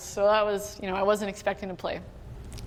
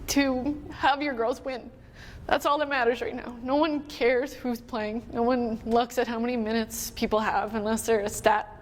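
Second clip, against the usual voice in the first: higher, choked-up retelling; real tearful voice; 4 semitones higher than usual. Real, not acted. Retelling her fiance's encouragement at the Olympics, genuinely tearful. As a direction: push through the pep talk while holding back tears.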